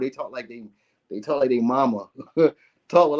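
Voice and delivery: ordinary talk, nothing marked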